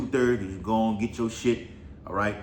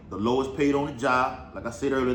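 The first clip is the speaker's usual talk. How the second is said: ordinary talk, nothing marked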